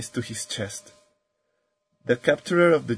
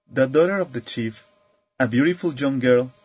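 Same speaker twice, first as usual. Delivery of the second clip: ordinary delivery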